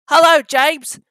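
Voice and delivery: a kindly, bustling little engine; kindly Thomas. Acted. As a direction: greet an old friend cheerfully. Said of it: "said Thomas kindly".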